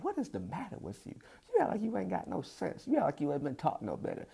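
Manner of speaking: scolding lady. Retold or imitated.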